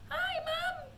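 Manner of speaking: very high mouse-like voice; tiny squeaky voice. A narrator's reading. Shrunken boy greeting his mom in a tiny squeak. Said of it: "A tiny little voice, no louder than the squeaking of a mouse".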